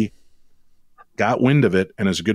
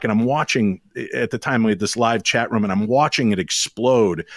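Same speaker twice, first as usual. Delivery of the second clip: ordinary talk, nothing marked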